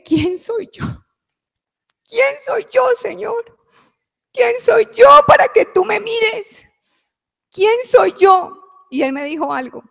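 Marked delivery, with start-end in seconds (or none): 0.0-8.6 high, anguished plea that breaks into a shout; sobbing plea to the Lord; tearful pleading cry. Retold or imitated. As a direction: cry out to God, overwhelmed and unworthy. Crying out to God in prayer.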